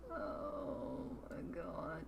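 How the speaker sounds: a breathless, slow whisper of shock; awed whisper. Real, not acted. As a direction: whisper it slowly, stunned. Shocked by news of marriage.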